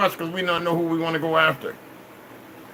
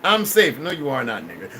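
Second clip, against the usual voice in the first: normal talking voice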